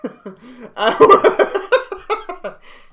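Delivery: a loud burst of laughter. Real, not acted. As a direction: laugh out loud at your own joke. Laughs at the Reddit joke.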